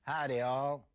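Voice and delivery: loud "Howdy, all" in a put-on Texan accent; mock Texan greeting. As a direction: greet them like a cowboy host, hammed up. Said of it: "what an interesting fella".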